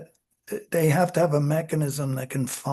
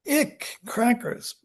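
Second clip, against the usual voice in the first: disgusted; 6 semitones higher, slower than usual. Retold or imitated. Experimenter acts disgust.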